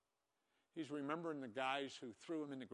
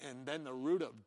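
ordinary delivery

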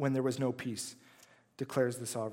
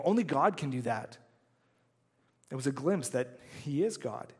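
usual speaking voice

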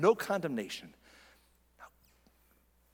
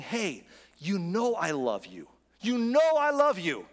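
emphatic, drawn-out declaration building louder and higher; God's insistent love; 5 semitones higher, 4 dB louder, slower than usual. Acted. Pastor as God assuring a listener of love, emphatically. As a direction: drive it home slowly, with rising force. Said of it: "I want you to hear him saying".

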